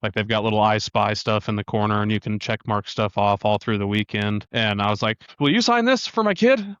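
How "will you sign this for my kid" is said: eager request. Retold or imitated. Asking a driver for autograph.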